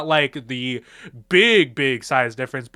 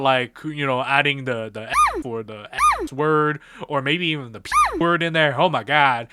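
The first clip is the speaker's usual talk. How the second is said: ordinary talk, nothing marked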